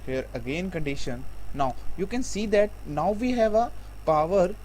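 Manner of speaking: ordinary talk, nothing marked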